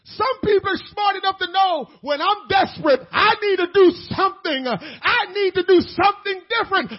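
loud, high, building repetition; full-force preaching. Acted. Preacher mocking comfort-seekers, loud and high.

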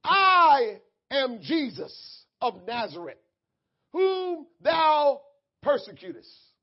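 slow, loud proclamation from heaven; grand, deliberate divine announcement; Jesus. Acted. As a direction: declare it weightily, stretching the first word. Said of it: "And he said unto me".

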